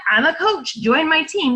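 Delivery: mock salesy coach pitch; pushy sales pitch. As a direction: play the pushy salesperson, lightly. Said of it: "So that's not you being like".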